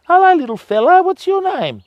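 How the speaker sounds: high doting dog-talk voice. Real, not acted. Greeting a passing dog.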